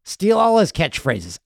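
normal talking voice

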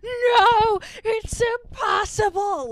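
high, frantic shriek of disbelief; crazed freakout. Retold or imitated. One-eyed prisoner freaking out at the TV, high crazed shriek. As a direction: shriek it like a man losing his mind. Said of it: "like, going crazy".

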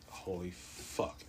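slow, breathy hushed curse; hushed dismay. Retold or imitated. Patient telling his own panicked reaction, hushed dismay. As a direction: let it out slowly under your breath.

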